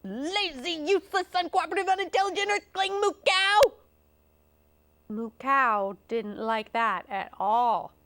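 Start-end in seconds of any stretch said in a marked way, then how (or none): 0.0-3.7 high, exasperated rant, building; high cowboy tirade gathering speed; yelled scolding. Acted. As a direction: pile up the insults faster and louder. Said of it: "and yelled".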